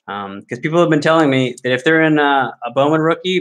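usual speaking voice

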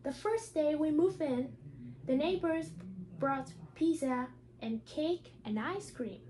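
ordinary talk

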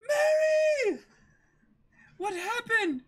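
high, loud desperate calling; panicked cry. Acted. Kaya finds Mary collapsed and cries out in high panic. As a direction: scream her name in panic.

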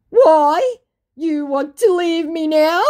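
high, loud wounded question; mock dismay. Scammer upset that she is leaving, mock dismayed. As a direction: plead in hammed-up disbelief.

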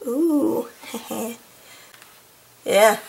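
ordinary delivery, nothing marked

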